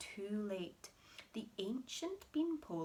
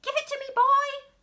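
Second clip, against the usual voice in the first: much higher and louder; yell; 17 dB louder than usual. Acted. Grandma yelling at George. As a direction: yell the demand. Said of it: "yelled Grandma".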